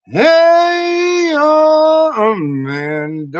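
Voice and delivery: long, high, wailing holler; stretched-out shouted greeting; calling out. Pretend. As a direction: hold the name out, sliding down from high. Drawn-out shout to listener.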